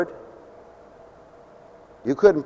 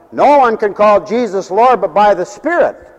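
preaching; 6 semitones higher, 6 dB louder than usual. Acted. Preacher declaring scripture.

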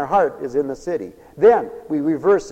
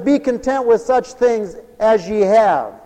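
loud, slow preached scripture; 8 semitones higher, 7 dB louder, slower than usual. Shown as a demonstration. Preacher proclaiming the verse to the congregation, loud and slow. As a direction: proclaim it slowly and loudly. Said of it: "Listen to it".

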